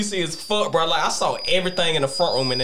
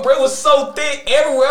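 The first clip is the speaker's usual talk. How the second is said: ordinary talk, nothing marked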